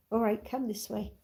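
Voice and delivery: ordinary talk, nothing marked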